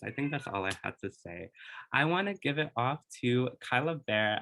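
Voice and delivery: usual speaking voice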